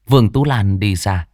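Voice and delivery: usual speaking voice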